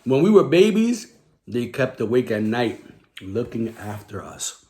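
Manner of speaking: ordinary delivery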